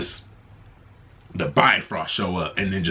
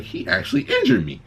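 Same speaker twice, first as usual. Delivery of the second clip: mock surprise. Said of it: "Thanos is like".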